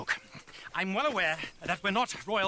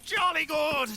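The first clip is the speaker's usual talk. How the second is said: jolly exclamation; 8 semitones higher, 4 dB louder, slower than usual. Acted. Exuberant British showman.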